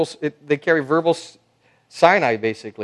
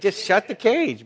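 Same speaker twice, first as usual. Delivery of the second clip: higher and slower, playful command; urging the crowd in a lively, slower voice; animated punchline; 7 semitones higher, slower than usual. Acted. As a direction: tell them to just shut it, with a grin.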